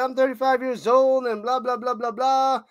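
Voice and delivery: high, lightly mocking complaint; mock upset player. Commentator retelling the upset player's complaint, mock upset. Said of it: "She was a little upset. She's like".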